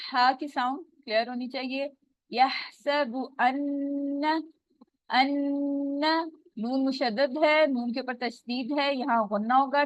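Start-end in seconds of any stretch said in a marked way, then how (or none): 2.3-6.3 अन्न held two counts through the nose; nasal ghunna. Shown as a demonstration. Teacher showing the ghunna on अन्न. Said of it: "टू counts जितना गुन्ना".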